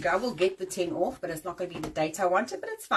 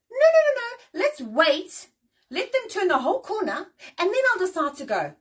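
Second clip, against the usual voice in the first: much higher, louder mock dithering voice; 9 semitones higher, 6 dB louder than usual. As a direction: mimic a dithering driver to ridicule them. Mocking slow drivers at corners.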